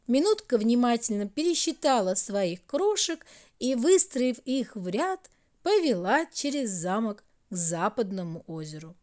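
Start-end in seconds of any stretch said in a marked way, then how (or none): none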